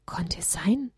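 soft unvoiced whisper; hushed, breathless whisper of wonder. A narrator's reading. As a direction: breathe the question in awe. Narrator's hushed wondering question.

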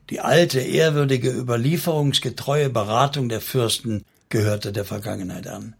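plain reading voice

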